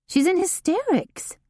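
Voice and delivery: lightly voiced puzzled assistant; Haley voiced. A narrator's reading. Haley's voice, read by narrator.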